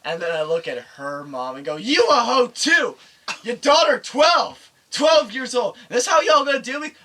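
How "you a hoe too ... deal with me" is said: loud, high accusing rant; outraged tirade. Retold or imitated. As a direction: yell in outrage at the girlfriend's mother. Acted tirade at girlfriend's mom.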